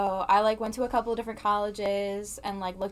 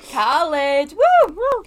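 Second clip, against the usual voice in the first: cheering; 7 semitones higher, 13 dB louder, much slower than usual. Real, not acted. Cheering about college.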